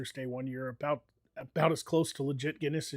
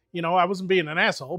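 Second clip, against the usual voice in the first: ordinary delivery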